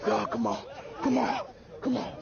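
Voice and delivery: low, soft coaxing calls; soft come-on-follow-me encouragement. Real, not acted.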